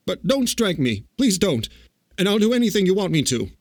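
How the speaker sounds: high, anxious begging; frightened pleading. A narrator's reading. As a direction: beg for mercy, hurrying at the end. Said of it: "said the little man in a trembling voice".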